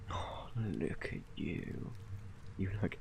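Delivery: slow hushed whisper. Real, not acted. Parent gazing at sleeping baby, slow soft whisper.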